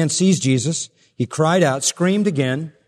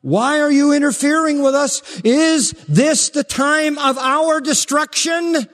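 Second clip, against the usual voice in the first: shrill demon pleading, higher and louder; panicked demon voice; 11 semitones higher, 4 dB louder than usual. Acted. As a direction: shout it out like a terrified demon. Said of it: "The demon says".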